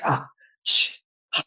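breathy mock grunts on stage; tennis grunt. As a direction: imitate her grunting like Jimmy Connors. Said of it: "She's like".

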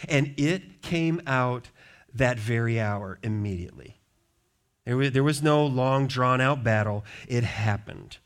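ordinary talk, nothing marked

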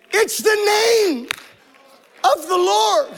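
high, broken-up, emphatic cry; high proclamation. Real, not acted. Preacher hammering home the name, high and emphatic. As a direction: pitch it high and separate each word. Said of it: "so emphatic about calling the name".

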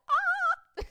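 high, loud squeal of joy; excited squeal. Real, not acted. A proud mom squeals over her son on TV, thrilled. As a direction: let out a thrilled shriek. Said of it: "I'm just getting excited all over again".

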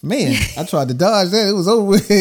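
laughing. Pretend. Joking he dodged the shots.

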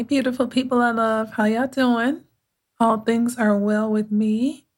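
ordinary talk, nothing marked

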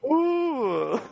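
high wailing ooh; bunyip call. Retold or imitated. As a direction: moan like a swamp creature. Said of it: "do our bunyip call".

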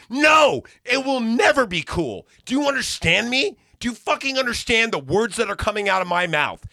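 high-pitched, emphatic furious tirade. Retold or imitated. Answering the man who offers to return paintings, furious.

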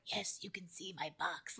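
sinister whispered offer; the scary stranger he isn't, whispered; creepy whisper. Retold or imitated. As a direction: whisper it like a horror villain. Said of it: "He's not like".

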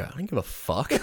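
a dismissive quote cracking up mid-line; laughing. Retold or imitated. Joking about ignoring fan messages.

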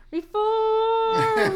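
high, loud nasal shout; Preston Manning shout. Retold or imitated. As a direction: belt it out. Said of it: "who loved to shout".

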